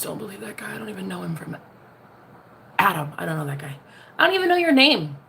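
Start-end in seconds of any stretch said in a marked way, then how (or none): 0.0-3.8 hushed secretive whisper; whispered aside. Acted. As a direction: lean in and whisper the denial.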